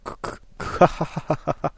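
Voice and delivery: slow breathy villain cackle; evil cackle. Acted. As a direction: let a sinister laugh leak out. Masked figure laughs menacingly. (不気味な笑い)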